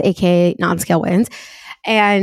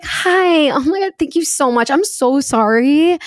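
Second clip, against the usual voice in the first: higher, overly apologetic service voice; customer service voice; 5 semitones higher than usual. Retold or imitated. Answering the phone to a customer, in a higher service voice. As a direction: gush apologies like a call-centre agent. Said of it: "my customer service voice is, like, a different voice".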